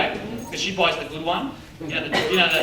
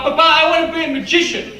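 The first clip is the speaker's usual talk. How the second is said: put-on higher, louder child pleading; little boy; 8 semitones higher, 7 dB louder than usual. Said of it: "I said to my papa".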